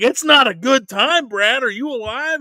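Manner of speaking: snapping at a caller mid-game; exasperated outburst. Retold or imitated. Host to friend calling mid-game, exasperated. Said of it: "I'm like".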